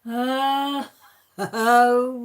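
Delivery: loud laughing "oh" of sudden understanding; loud laughing oh of realisation. Retold or imitated. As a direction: burst out laughing as it clicks. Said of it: "and then it was like".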